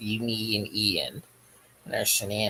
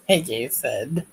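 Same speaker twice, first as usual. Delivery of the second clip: ordinary talk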